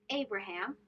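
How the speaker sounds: normal talking voice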